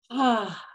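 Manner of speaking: short sigh letting sound out; audible sigh. Shown as a demonstration. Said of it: "Allowing sound to come out".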